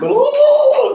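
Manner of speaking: very high, loud cheering "Oh!"; huge crowd whoop; crowd roar. Retold or imitated. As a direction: imitate a whole room erupting. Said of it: "They make this racket".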